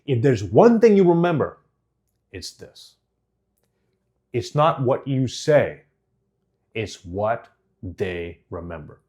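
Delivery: slow build-up, dropping soft and low; slow right down and let it sink in; slowed down, with pauses. Shown as a demonstration. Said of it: "I can slow down and say".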